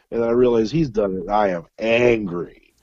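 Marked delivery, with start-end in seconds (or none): none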